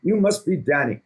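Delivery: a self-admittedly bad British accent, playing Gordon; Gordon Ramsay impression. Greeting Danny on Zoom as Gordon, in an attempted British accent. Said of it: "I can't do a Gordon accent, but I always try".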